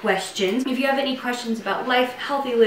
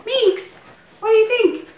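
high coaxing pet voice; dog-talk voice; 5 semitones higher, faster than usual. Real, not acted. Owner cooing to her dog Meeks. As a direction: coo at the dog, pitched up.

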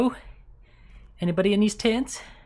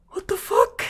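panicked shriek; 6 dB louder than usual. Real, not acted. Gasp then scare.

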